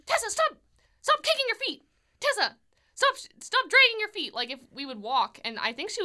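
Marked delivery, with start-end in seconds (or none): 0.0-4.3 much higher, louder, fast screeching nag; screechy Shelley voice. Retold or imitated. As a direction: screech orders at a small child. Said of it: "Shelley was very screechy".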